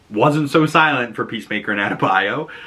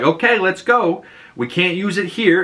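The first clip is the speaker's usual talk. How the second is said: Harcourt urging; 9 semitones higher than usual. Retold or imitated. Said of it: "And Harcourt's like".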